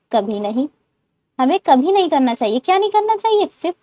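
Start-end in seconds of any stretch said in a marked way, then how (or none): none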